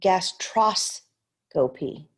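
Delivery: gastroscopy said slowly, syllable by syllable; slow careful gastroscopy; slow sounding out. Shown as a demonstration. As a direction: draw out gastroscopy. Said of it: "So let's look".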